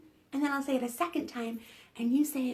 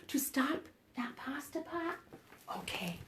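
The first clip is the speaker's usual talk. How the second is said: gasp, then a hushed question; whisper; 9 dB quieter than usual. Real, not acted. Whispering to the kids with a gasp. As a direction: whisper it like a secret. Asking kids about the magic.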